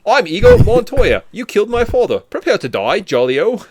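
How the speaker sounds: over-the-top mock British accent; bad British accent. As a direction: shout it like a cartoon Brit, British accent. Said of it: "I apologize".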